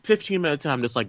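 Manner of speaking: normal talking voice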